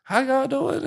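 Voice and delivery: imitating a friendly southern drawl; southern accent. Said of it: "that southern accent".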